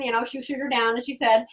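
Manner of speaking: ordinary talk, nothing marked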